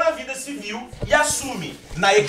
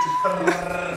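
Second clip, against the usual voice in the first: long rolled R trill; sustained "trrr" tongue roll; speech exercise trill; 3 semitones lower, much slower than usual. Shown as a demonstration. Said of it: "passar uns exercícios pra ele".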